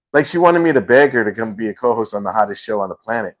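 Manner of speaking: usual speaking voice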